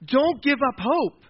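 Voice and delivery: high, loud, slow rallying plea; impassioned plea. Acted. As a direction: play an eager young champion urging the king. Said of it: "David came in with passion".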